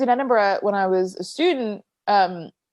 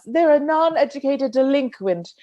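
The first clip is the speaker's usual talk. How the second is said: put-on British snootiness, higher pitched; mock British; 6 semitones higher than usual. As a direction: look down on them in mock British. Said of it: "it's the most British way".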